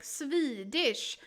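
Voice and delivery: "Swedish" slowed with a long I; emphasized Swedish I. Shown as a demonstration. Streamer puzzling over the accent, "Swedish" with an exaggerated I. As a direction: lean hard on the I in "Swedish". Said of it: "why do we emphasize the I".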